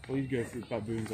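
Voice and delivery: ordinary delivery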